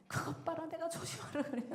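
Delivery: high-pitched mock nagging; imitated scolding. Korean mom scolds child who dropped the dish, mock scolding. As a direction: play a mother telling her child off. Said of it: "야단부터 먼저 친다네".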